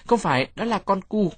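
put-on high-pitched protesting kid; child's yell. A narrator's reading. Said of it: "Tôi hét lên". (giọng trẻ con kêu lên, cao)

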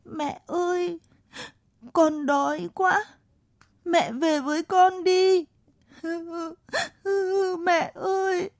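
put-on high, slow, tearful child's whimper. A narrator's reading. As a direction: whimper for mother, breaking into sobs. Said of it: "Cậu rên rỉ".

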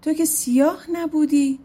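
taken aback by what he sees; surprised question. Acted. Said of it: "پسر پادشاه تعجب کرد".

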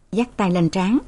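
ordinary delivery

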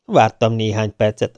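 ordinary delivery

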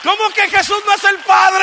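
loud, high, emphatic preacher's shout; fiery pulpit delivery; full-force preaching. Real, not acted. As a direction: proclaim it to the congregation, stretching key words.

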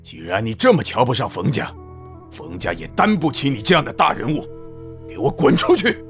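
put-on low, stern old man, furious; angry father. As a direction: throw him out in rage. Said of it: "冯老爷大怒".

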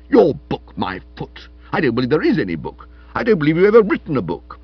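scornful, fast, rising in volume; spirited retort. A narrator's reading. Stilton scorning Florence's book, fast and spirited. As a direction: dismiss the claim with heat. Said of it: "retorted Stilton with spirit".

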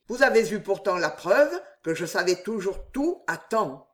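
ordinary delivery

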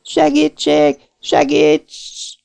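high panicked cry; screaming for help. Acted. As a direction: scream as hands grab you. Girl seized, calls for help. (kétségbeesett segélykiáltás)

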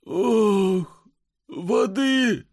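high gasping plea for water; gasp, clutching your heart; gasping for breath. Acted. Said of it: "задыхается больной".

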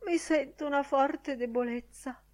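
high, feeble mock swoon; put-on faintness, voice lifted high; sighing, feigning breathlessness. A narrator's reading. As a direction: sigh it weakly as if winded. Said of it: "sospirò".

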